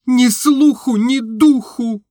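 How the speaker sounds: high, loud, then slow and breaking; near sobbing. A narrator's reading. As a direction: push it out, then let it break. Настенька on the verge of sobbing. (на грани рыданий, высоко и громко)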